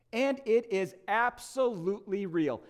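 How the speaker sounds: ordinary talk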